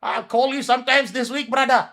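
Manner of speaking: high, loud mocking whine of his promise; sarcastic impression of a flaky friend; mocking imitation. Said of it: "You're like".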